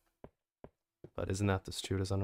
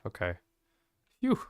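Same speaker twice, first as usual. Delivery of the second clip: normal talking voice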